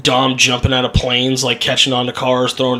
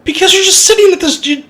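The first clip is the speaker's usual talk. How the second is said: mimicking the screen; 13 semitones higher than usual. Mimics giant screen motion.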